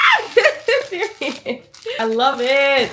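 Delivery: very slow, loud, laughing; drawn-out happy laugh and shout; delighted laughing shout. Real, not acted. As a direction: gush with joy. Loving the gift.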